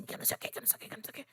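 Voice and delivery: airy, near-silent voice fading to nothing; whispering as if the voice is gone; voice giving out. Shown as a demonstration. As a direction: let the voice fail into a breathless whisper. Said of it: "minha voz ficava tipo assim".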